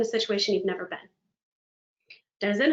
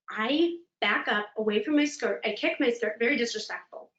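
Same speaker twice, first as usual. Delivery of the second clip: ordinary talk, nothing marked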